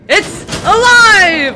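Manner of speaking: slow, high, loud cackling "It's alive!"; mad scientist. Pretend. Player hamming a mad scientist to his Igor, shouting with laughter. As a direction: crow it like a mad doctor whose monster wakes. Flipping switch, calling Igor.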